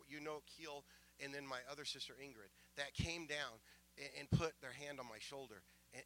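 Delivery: real emotion, softening as it goes; hushed, thankful testimony, holding feeling in; getting emotional. Real, not acted. Preacher remembering sisters who prayed for him, quietly emotional. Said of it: "I'm gonna get emotional here".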